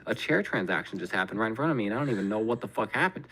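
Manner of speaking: ordinary talk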